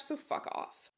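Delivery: plain reading voice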